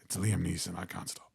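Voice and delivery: low, soft Northern Irish deadpan; Liam Neeson. Acted. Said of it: "I can do Liam Neeson".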